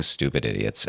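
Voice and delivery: ordinary talk, nothing marked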